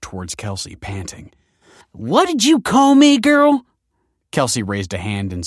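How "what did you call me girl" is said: menacing woman. Acted. Foster mother menaces Kelsey.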